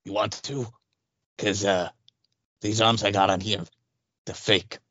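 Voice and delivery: low, halting creep voice; creepy candy seller. Acted. Creep admitting to fake arms, low sly voice. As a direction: confide it low and shady. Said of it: "This is Count Creepyhead candy bar".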